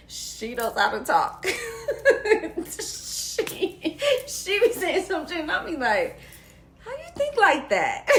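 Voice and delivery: loud, high, cracking up while talking; laughing admiration. Real, not acted. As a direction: marvel through real bursts of laughter. Laughing in admiration of her sister.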